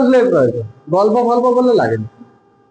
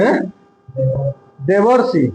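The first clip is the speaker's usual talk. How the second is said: normal talking voice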